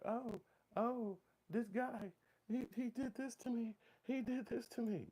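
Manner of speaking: high falsetto, mock-pitiful complaint; mocking whiny woman. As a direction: squeak it out like a woman crying victim. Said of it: "and just be like".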